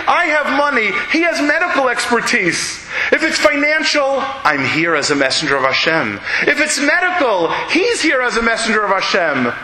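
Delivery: high, earnest pleading; begging. Acted. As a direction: plead with him, hands out. Visitors beg the man to accept.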